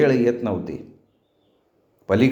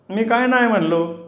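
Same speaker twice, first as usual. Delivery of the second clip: high-pitched protest; 9 semitones higher than usual. A narrator's reading. Man with twisted ear protests.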